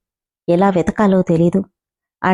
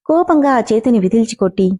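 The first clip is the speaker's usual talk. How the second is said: usual speaking voice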